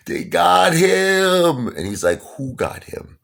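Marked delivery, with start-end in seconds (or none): 0.1-1.7 loud, high, drawn-out mock wail; imitated hysterical crying. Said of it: "hysterical crying". 2.4-3.2 higher, softer imitated disbelief; stunned father. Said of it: "my father was blown away".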